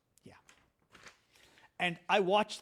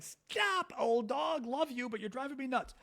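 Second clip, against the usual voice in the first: exasperated pleading; 7 semitones higher than usual. Retold or imitated. Owner to old whining dog, exasperated. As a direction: mock-desperate high plea to a pet. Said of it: "She's been whining for half an hour".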